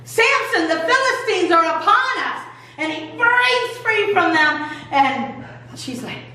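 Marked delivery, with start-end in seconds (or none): 0.0-2.4 high-pitched mock warning cry; raised-pitch, half-hearted yell; retold yell. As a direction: imitate an alarm call without much volume. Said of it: "and then she yells".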